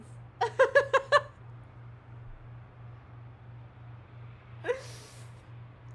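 wheezing laugh. Real, not acted. Breathy high laugh.

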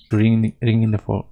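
normal talking voice

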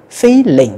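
"Feeling" with the first syllable high, the second falling; stress on first syllable. Shown as a demonstration. Teacher shows learners where the word stress falls, first-syllable stress on "Feeling". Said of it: "cái âm thứ hai các bạn phải chuyển thành dấu huyền".

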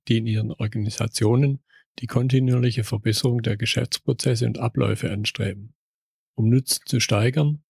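measured announcer read of the show opener; podcast intro read. Acted. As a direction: present the show like a radio host.

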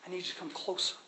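soft, close, tender call; gentle hushed invitation. Acted. Jesus softly calling people closer. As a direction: pull them in gently. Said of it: "He says".

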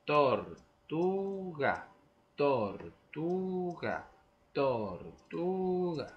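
tortuga drawn out very slowly in syllables; syllable rhythm. Shown as a demonstration. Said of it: "vamos a jugar así".

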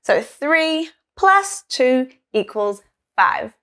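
each word spelt out slowly; slow recap for young learners; slow and clear. Shown as a demonstration. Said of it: "slow recap of the sum".

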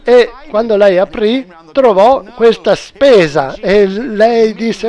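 ordinary talk, nothing marked

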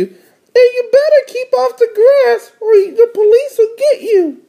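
very high, bossy little-sister voice. Acted. Little sister warning her brother off the grass, very high voice. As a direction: warn in a squeaky cartoon kid voice. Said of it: "said D W".

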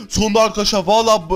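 ordinary delivery

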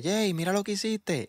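mild mock call-back; imitated calling out. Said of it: "me está llamando para".

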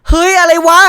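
sharp high startled yelp. Real, not acted. Gamer startled by the horror screen, yelping high. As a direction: jump at the sudden screen.

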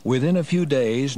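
ordinary talk, nothing marked

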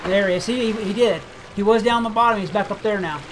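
ordinary talk

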